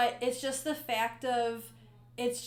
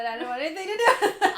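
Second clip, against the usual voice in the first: quick, louder, higher laughing words; 5 semitones higher, 7 dB louder, much faster than usual. Real, not acted. Co-host owning up to dodging the accents, laughing. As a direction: rush it out through a laugh.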